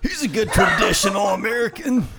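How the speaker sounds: put-on high, laughing old geezer voice; a hammy old patriot, cracking up; old man voice. Keeps the old man bit.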